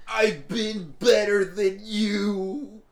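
slow, drawn-out mock rapper boast; lightly voiced rapper brag; imitating [name]. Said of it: "that's exactly how he said it too".

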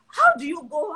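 high, loud indignant challenge; outraged question. Acted. Church member whose chosen brother was taken, outraged question. Acted outrage at newcomer marrying first.

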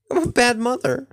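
high, loud hammed-up wail of guilt; mock self-scolding. Gamer who forgot her daughter's name, hammed-up high wail. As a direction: cry out in melodramatic shame.

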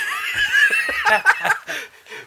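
shrieking high laugh. Real, not acted. Big laugh.

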